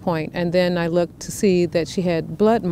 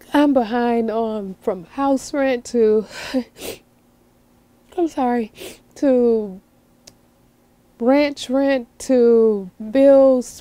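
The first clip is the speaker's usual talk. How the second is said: real tearful struggle, pitched higher; choking up; 5 semitones higher, slower than usual. Real, not acted. Ranch owner admitting she is behind on rent, choking up. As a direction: drag the words out, holding back tears. Tearful over debts, says sorry.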